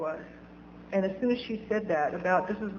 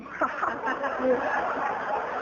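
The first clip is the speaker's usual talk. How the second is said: forced joking laugh; 3 semitones higher than usual. Retold or imitated. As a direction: fake ha-ha-ha to cover up. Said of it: "so I tried to make, I was joking".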